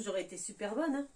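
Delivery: usual speaking voice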